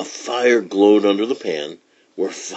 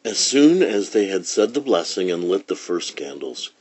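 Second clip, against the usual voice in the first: usual speaking voice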